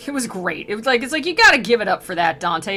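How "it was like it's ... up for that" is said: ordinary delivery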